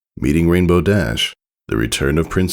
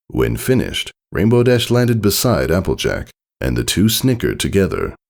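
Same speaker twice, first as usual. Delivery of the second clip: ordinary delivery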